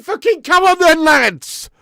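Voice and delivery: exasperated shout. Real, not acted. Fan yelling at his sluggish team, real frustrated shout. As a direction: roar to wake the team up.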